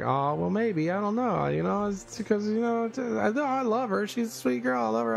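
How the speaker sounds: mock flustered self. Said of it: "And I'm like".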